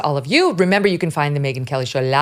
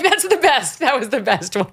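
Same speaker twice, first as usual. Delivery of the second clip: laughing praise; 7 semitones higher than usual. Retold or imitated. Laughing, praising son's retort.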